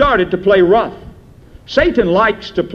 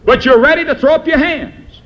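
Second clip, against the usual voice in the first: ordinary talk, nothing marked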